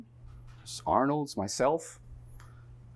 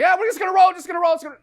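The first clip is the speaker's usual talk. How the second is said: very high, loud director yelling; frantic dismissal; 12 dB louder, much faster than usual. Retold or imitated. Director interrupts two quarrelling actors, frantic yelling. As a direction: yell over the argument to get rolling. Director cuts across the confrontation.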